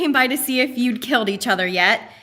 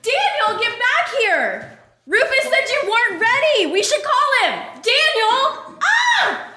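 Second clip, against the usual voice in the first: calling after him; 11 semitones higher than usual. Acted. Calling after Daniel running off.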